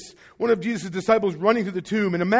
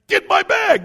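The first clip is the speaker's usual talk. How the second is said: high, loud, urgent yell; mock panic; 10 semitones higher, 6 dB louder than usual. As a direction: pretend panicked shout. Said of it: "She wasn't like".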